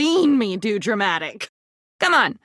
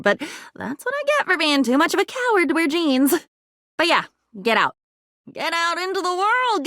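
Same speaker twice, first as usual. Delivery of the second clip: ordinary talk, nothing marked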